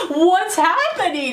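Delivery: high, drawn-out bewildered question; high acted confused wail; mock panic. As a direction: stretch it out in comic alarm. Freddy voiced, alarmed.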